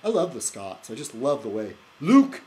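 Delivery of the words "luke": loud, high Scottish call; attempted Scottish accent. Vlogger trying out a Scottish accent he loves, badly faked Scottish accent. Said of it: "I can't do a Scottish accent".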